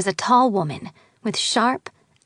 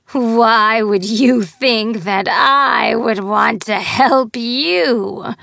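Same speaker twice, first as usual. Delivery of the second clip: scornful shout; 2 semitones higher, 6 dB louder, much slower than usual. Acted. Fairy scorns the girl.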